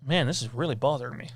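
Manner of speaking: ordinary delivery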